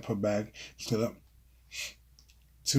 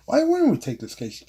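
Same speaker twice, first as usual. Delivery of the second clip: indignant surprise; 12 semitones higher, 5 dB louder than usual. Acted. Jason defends taking the case.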